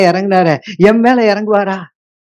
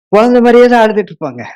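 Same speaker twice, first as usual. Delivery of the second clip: heated preaching; 5 semitones higher, 5 dB louder, faster than usual. Acted. Preacher mocks childish prayers.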